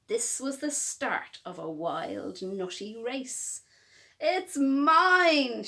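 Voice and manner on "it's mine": loud possessive shout, "mine" hit hard. Acted. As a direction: shout it out like a greedy squirrel. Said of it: "shouted Cyril".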